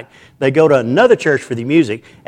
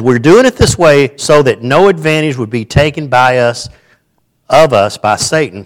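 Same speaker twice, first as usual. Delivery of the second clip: louder, measured reading of the verse aloud; scripture reading; 6 dB louder than usual. Acted. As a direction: read the passage out to the church. Reads scripture to congregation.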